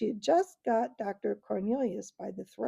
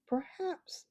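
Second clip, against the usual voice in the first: cold voice; 9 semitones higher, 6 dB quieter than usual. Acted. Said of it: "said Knickerbock in a cold voice".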